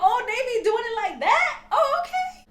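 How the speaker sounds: very high, loud mock squeal; shrieking disbelief. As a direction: squeal it like you can't believe the find. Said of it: "I was like".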